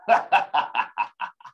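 villainous cackle, breathy and unvoiced; maniacal laugh. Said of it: "I don't know why I did that maniacal laugh".

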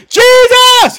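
very high, loud held cry of Jesus; loud shout. Retold or imitated. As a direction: cry out with everything you have. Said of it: "It said he got louder".

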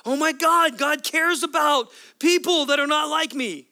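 high and loud, slowing on the second line; awed realization. Retold or imitated. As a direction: gasp out a sudden discovery. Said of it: "you're like".

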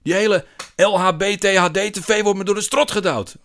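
loud, high-pitched tirade; outraged rant. A narrator's reading. Man ranting about what is forced on him, outraged. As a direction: let the grievance burst out.

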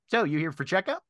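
high bird voice, rising at the end; Drummond. Acted. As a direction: pitch it up as a bird asking a question. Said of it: "and then Drummond's voice goes".

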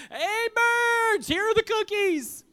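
high, loud holler; full-voiced storybook yell, words stretched; loud shout. A narrator's reading. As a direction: shout out to birds far off. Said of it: "He shouted in a loud voice".

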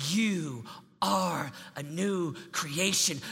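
slow declaration. Acted. Preacher telling teens who they are, slowly. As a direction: weigh every word. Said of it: "emphatic slow declaration".

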